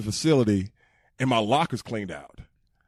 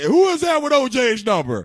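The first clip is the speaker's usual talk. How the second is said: retold yell; 11 semitones higher, 6 dB louder than usual. Repeating the crowd yell.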